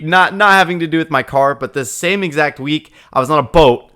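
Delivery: ordinary talk, nothing marked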